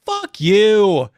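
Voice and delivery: sudden high, loud shout at the screen; angry yell of fuck you at an ad; yelled curse. Retold or imitated. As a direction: blurt it out full force, fed up. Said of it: "And I yelled".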